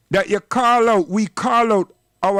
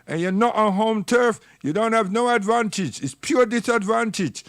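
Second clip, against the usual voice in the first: normal talking voice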